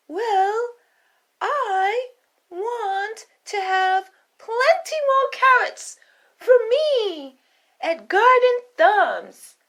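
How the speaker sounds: a little rabbit striking a deal; eager high rabbit. Acted. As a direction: rabbit bargaining with the queen in a squeaky voice. Rabbit states wish.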